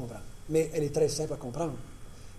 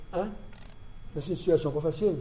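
ordinary talk, nothing marked

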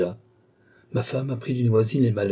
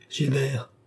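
higher exclamation of recognition; 5 semitones higher than usual. A narrator's reading. Philippe recognising the young man, exclaiming. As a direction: call the name in astonishment. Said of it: "s'écria à son tour Philippe".

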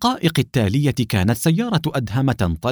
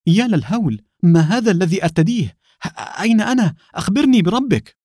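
high, loud astonished cries; feigned shock; 9 semitones higher, 3 dB louder than usual. Acted. Adham faking shock to the officer, high and loud. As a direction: play stunned, voice climbing high. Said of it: "وصاح بمزيج من الدهشة والذهول".

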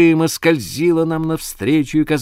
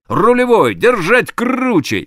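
command; 9 semitones higher, 4 dB louder than usual. Acted. Captain commanding helmsman.

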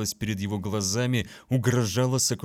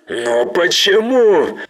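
anguished cry, much higher and louder; shout; 9 semitones higher, 12 dB louder, slower than usual. Acted. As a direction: cry out in despair. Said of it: "закричал Гаро".

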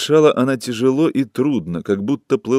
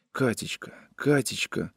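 soft, much quieter begging; pleading whisper; 10 dB quieter than usual. A narrator's reading. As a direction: breathe her name softly, begging. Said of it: "шептал он умоляюще".

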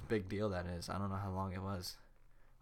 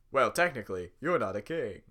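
talk show host; 7 dB louder than usual. Acted. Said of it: "he sounds like a talk show host".

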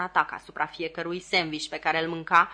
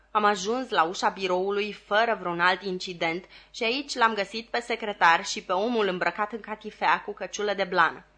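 plain reading voice

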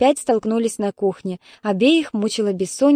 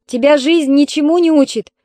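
raised, higher and louder call; calling after her; 6 semitones higher, 6 dB louder than usual. Acted. Mother calls after her leaving daughter, raised voice. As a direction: shout it after her as she leaves. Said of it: "крикнула ей вслед Ольга Петровна".

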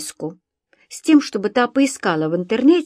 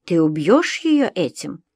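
usual speaking voice

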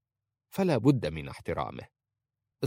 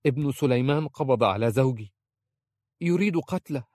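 higher-pitched urgent report; hurried urgency; 5 semitones higher, 3 dB louder than usual. A narrator's reading. Aisha telling her uncle of her husband's arrest, urgently. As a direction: blurt out the alarming news, voice raised in pitch. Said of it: "قالت مسرعة".

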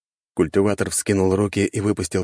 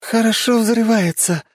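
high, slow, loud marvelling; awed exclamation; 5 dB louder, slower than usual. A narrator's reading. Young woman watching the explosion from the ship, awed exclamation. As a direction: exclaim in wonder at the blast. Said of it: "ахнула Чо Сайон".

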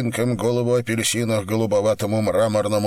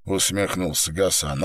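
ordinary delivery, nothing marked

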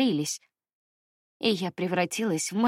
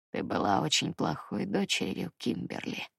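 much lower, quiet rasping creature; menacing monster father; 12 semitones lower, 8 dB quieter than usual. A narrator's reading. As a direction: hiss out a father's cold reproach. Monster father accuses his daughter.

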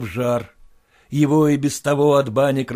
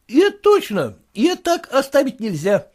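much higher, fussy clerk's voice; clerk reporting; 12 semitones higher, 5 dB louder than usual. Acted. The clerk tells his master about the drunk neighbour, fussy.

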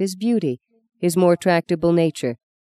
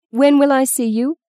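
higher, louder eager question; 9 semitones higher, 4 dB louder than usual. A narrator's reading. Justine eager to see Dane again, bright and higher. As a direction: ask quickly, keen.

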